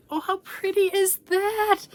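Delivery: very high-pitched cooing delight; delighted admiration. Real, not acted. Vlogger seeing her tote bag, delighted admiration.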